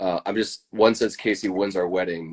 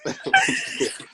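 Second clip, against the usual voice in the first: high-pitched laugh. Real, not acted. Laughing at shotgun wedding joke.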